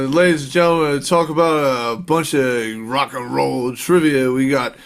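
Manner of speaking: bumbling TV host introducing a guest, words slurred and garbled; Steve Brule. Acted. Introducing a guest on Check It Out, bumbling host impression. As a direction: mangle the words like an awkward show host. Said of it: "ready for my Steve Brule impression".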